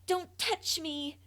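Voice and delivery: high, loud, frightened shriek; child shrieking at her mother to stay away; terrified shriek. A narrator's reading. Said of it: "I shrank back and shrieked".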